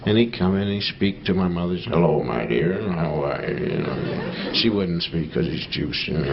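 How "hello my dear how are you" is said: put-on low, slow drunken greeting; a hint of a tipsy dad; father coming home loaded.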